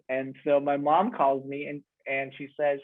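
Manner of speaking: normal talking voice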